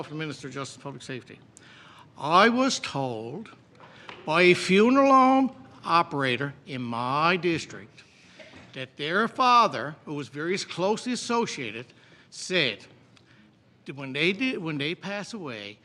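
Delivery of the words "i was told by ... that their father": slow, deliberate and louder, word by word. Shown as a demonstration. To the Minister of Justice, pointedly slow and loud. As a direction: spell it out slowly for the minister who wasn't listening. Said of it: "and I'll say it slow".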